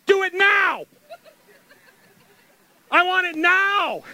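put-on loud, high, impatient child-like shout; demanding shout. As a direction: yell it like a kid who can't wait. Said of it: "We're like".

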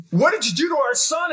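wife confronting her husband about their son; alarmed wife. Retold or imitated. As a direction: mimic a shocked wife. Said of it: "My wife is looking at me like".

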